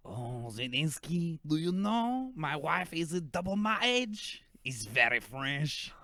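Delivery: hammed-up French drawl as Macron; teasing Macron in a fake French accent. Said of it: "do a fake French accent".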